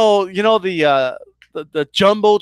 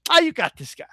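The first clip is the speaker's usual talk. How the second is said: dismissive; 6 semitones higher, faster than usual. Retold or imitated. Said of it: "Just dismissed him".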